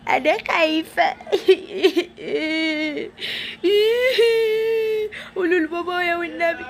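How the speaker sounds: high, whimpering put-on cry; mock sobbing girl. Kidnapped girl crying for her dad, whimpering. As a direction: wail like a scared child.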